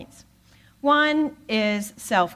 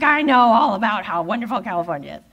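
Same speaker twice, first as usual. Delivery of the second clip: sarcastic remark; 2 semitones higher, 6 dB louder, faster than usual. Retold or imitated. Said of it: "why do you say it like that".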